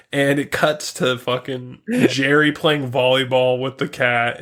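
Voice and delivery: normal talking voice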